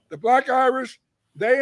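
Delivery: ordinary talk, nothing marked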